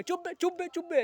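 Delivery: high barked "chup be", a cop silencing a citizen; mocking cop's shout. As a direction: shout it down like a bullying constable. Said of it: "तो कहेंगे". (पुलिसवाले की नकली डाँट, ऊँची आवाज़ में)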